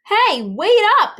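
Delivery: high, loud call, slowed; hey wait up, shouted high and slow; yell. A narrator's reading. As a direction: call out to stop them. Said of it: "yelled the baby's mother".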